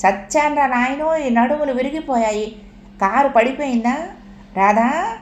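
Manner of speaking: panicked grandma, pitched high, calling for everyone; grandma wailing. A narrator's reading. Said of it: "ఎవరో చచ్చినట్లు బామ్మగారు అరుస్తోంది".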